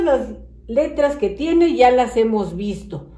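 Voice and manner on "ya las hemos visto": ordinary delivery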